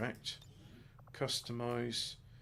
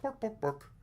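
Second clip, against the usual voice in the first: high, fast mock Swedish gibberish; Swedish Chef; 11 semitones higher, 4 dB quieter than usual. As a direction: babble like a cartoon Swedish cook. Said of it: "the Swedish chef from the Muppets".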